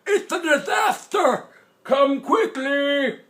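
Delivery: put-on high, slow, loud toothless old man yell; Grandpa shouting. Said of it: "Grandpa shouting".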